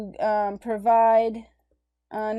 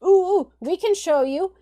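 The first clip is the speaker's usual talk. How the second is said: high, loud, eager "ooh, we can show you"; gleefully offering up the Aztecs; eager tattling; 6 semitones higher, 7 dB louder than usual. Retold or imitated. Tributary states eagerly pointing conquistadors to the Aztecs, excited. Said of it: "all the tributary states are like".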